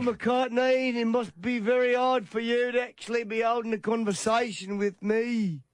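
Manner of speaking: sneering Scouse Paul voice, pitched high; condescending Scouse accent. Retold or imitated. To the podcast hosts, mocking Paul in a high condescending Scouse accent. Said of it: "I'll do the accent".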